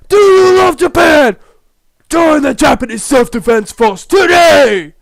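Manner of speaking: high, very loud recruiter's bellow; yelling at full volume. Acted. Mustached patriot recruiting passers-by, yelling at the top of his lungs. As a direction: shout it like a rallying cry. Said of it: "yelling at the top of his lungs".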